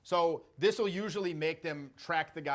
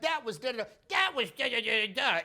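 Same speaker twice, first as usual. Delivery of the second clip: high, mocking sing-song; 7 semitones higher, 3 dB louder than usual. Shown as a demonstration. He repeats what they said in a high mocking voice. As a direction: parrot them to mock them. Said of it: "in a mocking voice".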